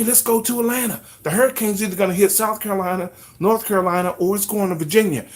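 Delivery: mock panicked evacuee rallying everyone to Georgia; excited evacuees. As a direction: hype everyone up to flee to Atlanta, shouting the last order. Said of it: "people that are like".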